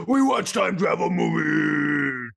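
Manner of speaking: slow shouted macho promo; monster truck ad. Acted. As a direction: boom it like a truck rally ad. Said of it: "talk like monster truck rally commercials".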